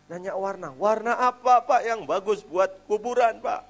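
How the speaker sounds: high, loud, slow disbelief; the preacher echoes the man's odd question in loud, high disbelief; incredulous. Retold or imitated. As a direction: repeat it back as if you can't believe it.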